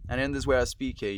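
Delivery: amateur attempt at a British accent. Shown as a demonstration. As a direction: put on a British accent on request. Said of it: "can you do a British accent".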